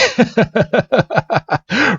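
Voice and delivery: high staccato acted laugh; long hammed-up cackling laugh; mocking laughter. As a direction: laugh at your rival's expense. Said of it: "Blue just starts laughing".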